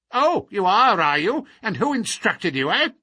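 loud, high, sceptical retort; querulous old man, indignant. A narrator's reading. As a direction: pounce on them like a crotchety old man.

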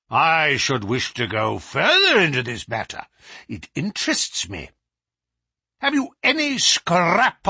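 insistent professor demanding proof; pressing interrogation. A narrator's reading. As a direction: lean hard on each demand.

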